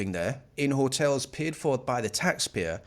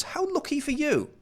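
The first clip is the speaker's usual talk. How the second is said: high, mocking singsong; sarcastic; 7 semitones higher than usual. Mocking the Epping lady, sarcastic singsong. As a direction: needle her with fake envy.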